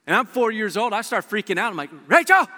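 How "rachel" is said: high, loud panicked yell; a lost child shrieking for his sister; panicked shout. Retold or imitated. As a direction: scream her name as a scared little kid. Lost four-year-old calling sister.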